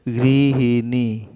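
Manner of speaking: গৃহিণী stretched out very slowly, every syllable separated; teacher drilling গৃহিণী for students; very slow pronunciation. Shown as a demonstration. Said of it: "এই যে এই উচ্চারণটা হবে".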